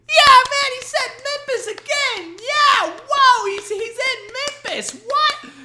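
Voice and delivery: very high, slow dopey falsetto; dumb crowd member. Retold or imitated. Mocking the crowd cheering Memphis, dopey falsetto. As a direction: squeak like a dim audience member.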